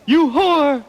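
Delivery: mock yell. Poem's narrator acts out Blackswill's outburst, mock yell. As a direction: pitch it up like an outraged yell, without real volume. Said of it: "started to yell".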